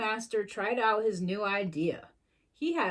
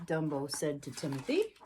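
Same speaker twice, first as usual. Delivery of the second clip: ordinary delivery, nothing marked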